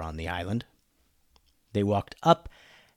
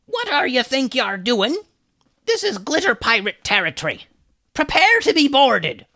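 high, loud pirate yell; 12 semitones higher, 4 dB louder than usual. Acted. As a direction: shout it from a passing ship. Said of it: "a pirate yelled at them".

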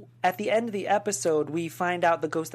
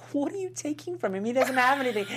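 high, disbelieving question; baffled at the robbery; incredulous; 7 semitones higher than usual. Retold or imitated. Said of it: "I was like".

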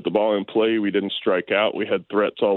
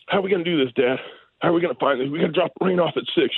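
put-on higher, excited kid's voice; eager young son; 7 semitones higher, faster than usual. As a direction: play the anxious little boy planning the evening. Son voiced asking dad anxiously.

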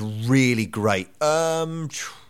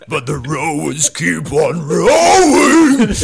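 slow, loud, higher Manson drawl; 8 semitones higher, 12 dB louder, slower than usual. Retold or imitated. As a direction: stretch each word out, creepy and slow. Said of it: "in a very Manson drawl".

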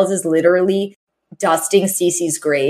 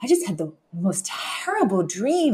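much softer, hushed and drowsy; weak groggy voice; 7 dB quieter than usual. Retold or imitated. Mister Sheffield feigning a nightmare to Fran, hushed. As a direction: murmur like just woken from a nightmare. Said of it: "he's like".

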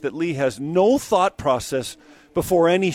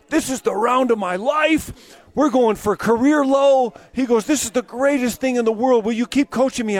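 excited Lee; 7 semitones higher, 3 dB louder than usual. Retold or imitated. Impersonating Lee's excitement to the host. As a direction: gush like an eager golfer.

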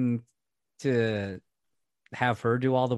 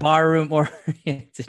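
laughing; 4 semitones higher, 7 dB louder, slower than usual. Real, not acted. Repeating quote laughing.

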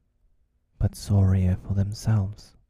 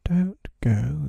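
high, louder plea full of pity; pitying call; 8 semitones higher, 5 dB louder than usual. A narrator's reading. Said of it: "they called in pity".

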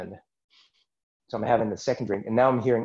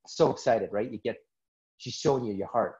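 ordinary talk, nothing marked

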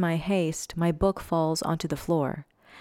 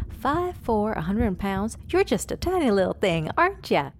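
chatty nurse; 4 semitones higher than usual. Acted. Chatty nurse teasing patient.

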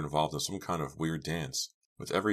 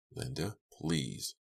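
slow, softened plea; quiet, drawn-out pleading; begging; 6 dB quieter, much slower than usual. A narrator's reading. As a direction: beg her, drawing out each word. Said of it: "Now Farberson was begging".

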